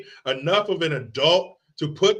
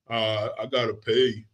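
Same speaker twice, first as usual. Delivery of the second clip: lightly voiced Forrest Gump, a little lower; 3 semitones lower than usual. Quoting Forrest Gump to the stream, a lightly voiced Gump. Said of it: "We all remember Forrest Gump".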